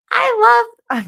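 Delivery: high, loud burst of delight; excited squeal. Real, not acted. Gushing to the viewers about a childhood favourite, high and loud. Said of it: "bursts into a squeal".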